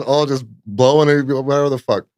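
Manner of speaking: usual speaking voice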